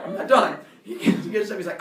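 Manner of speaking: ordinary talk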